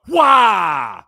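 loud, high, stretched "Wah!" in an American accent; American-accented Korean wow. Shown as a demonstration. Host teaches listeners to say it in American accent, loud exclamation. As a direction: shout it long and falling, American-accented. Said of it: "say it with your American accent".

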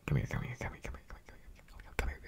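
coaxing her closer, whisper dying away. Acted. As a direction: whisper fading almost to nothing. Whispered pleading, fading away.

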